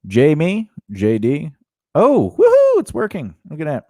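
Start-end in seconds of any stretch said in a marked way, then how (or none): 1.9-3.3 excited. Real, not acted. Excited about the stream working.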